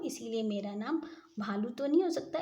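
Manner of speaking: ordinary delivery, nothing marked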